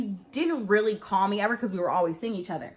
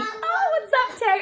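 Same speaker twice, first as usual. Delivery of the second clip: much higher, breezy imitation of the boyfriend; mock casual greeting; 13 semitones higher than usual. Imitating the boyfriend greeting her at his door, breezy and high. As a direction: chirp it like he's acting like nothing happened. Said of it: "He told me".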